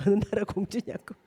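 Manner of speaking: amused mock question through laughter; laughing retell. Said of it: "물어보더래". (외국 아이 질문을 웃으며 흉내)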